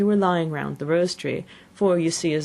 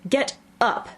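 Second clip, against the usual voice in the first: high, sharp royal order; shrill Queen's call; shrill, loud command; 7 semitones higher than usual. A narrator's reading. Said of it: "in a shrill, loud voice".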